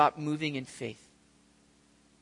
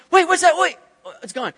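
high, loud startled "wait, what's that" fading to puzzled; alarmed, then deflated as it vanishes; startled disciples; 12 semitones higher, 11 dB louder, faster than usual. Retold or imitated. Disciples losing the figure behind a wave, startled then puzzled. Disciples lose sight in waves.

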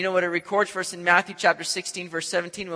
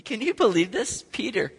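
ordinary talk, nothing marked

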